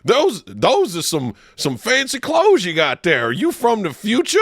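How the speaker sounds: high, slow, hammy period-actor voice; character actor voice. Retold or imitated. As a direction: play the costumed actor, slow and grand.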